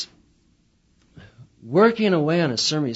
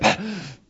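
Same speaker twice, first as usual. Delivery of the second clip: startled gasp; 6 semitones higher than usual. Acted. Waking in panic.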